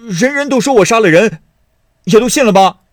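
high, loud, fast protest; indignant shouting. A narrator's reading. Son to mother who blames him, indignant shout. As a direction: shout back as the wrongly accused.

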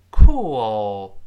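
"cool" read loud and slow, L swallowed into an o sound; model reading. Shown as a demonstration. Teacher modelling "cool" loudly for pupils to repeat. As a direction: say "cool" loudly for the class to copy. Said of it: "跟老师读一下这个词".

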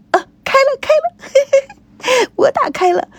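high, giddy cry of triumph; high excited girl voice with a laugh; excited girlish squeal. Acted. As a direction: squeal like a kid who cracked it. Girl opens the safe excitedly.

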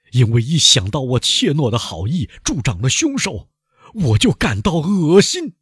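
loud, high declaration building to disgust; resonant loud voice. A narrator's reading. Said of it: "他用洪亮的声音说". (声音洪亮，越说越激动)

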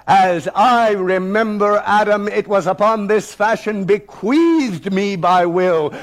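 bold, ringing schoolboy recitation; thundering, commanding. Acted. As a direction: read it out with total assurance and challenge. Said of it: "I read with thunder and assurance and command".

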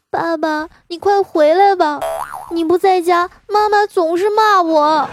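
very high, drawn-out child voice, imitating a wail; tearful little boy. Son begging dad to come home, mock-tearful wail. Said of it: "还带着哭腔地说".

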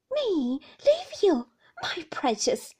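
slow, high, loud disbelief; loud, fond indignation; indignant cry. A narrator's reading. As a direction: cry it out, drawing out each word. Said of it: "cried Peggotty".

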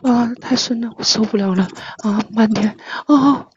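put-on pleading moans between short phrases; hammed-up breathless pleading; mock moaning. Streamer doing a forfeit for co-hosts, mock moaning.

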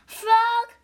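loud high call to Frog. A narrator's reading. Toad calling out to Frog in the woods, loud call. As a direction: shout Frog's name into the night. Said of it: "cried Toad".

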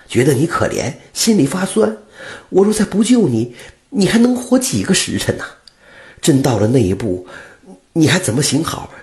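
pleading butcher. Acted. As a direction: defend yourself sincerely. Butcher pleads his good heart. (屠夫恳切地辩解)

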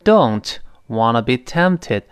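slow demo with don't held apart; teacher shows where words do not link; slow model reading. Shown as a demonstration. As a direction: model each word slowly. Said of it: "怎么样呢".

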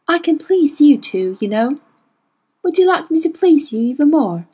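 normal talking voice

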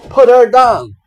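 a high, loud cry to stop him. A narrator's reading. The spider yells at the wolf to let her go, loudly. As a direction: shout to make the wolf drop her. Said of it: "came a cry".

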